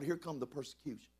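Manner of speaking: ordinary delivery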